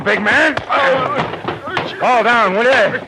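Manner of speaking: loud, high taunt between blows; taunting shout. Acted. Marlowe slugging the thug, loud mocking taunt. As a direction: jeer at him as you swing.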